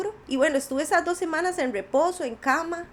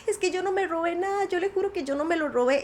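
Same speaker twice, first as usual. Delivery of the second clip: raised-pitch imitation of pleading innocence; retold tearful plea; 4 semitones higher than usual. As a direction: insist as if once in tears, without actually crying. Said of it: "yo lloraba y lloraba y yo empecé a decirle". (súplica llorosa, solo imitada)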